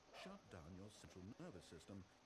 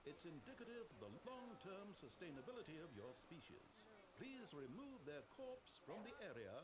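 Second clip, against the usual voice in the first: higher voice hailing the room; calling out; 9 semitones higher than usual. Acted. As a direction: call out to whoever is nearby. Calling out to anyone nearby.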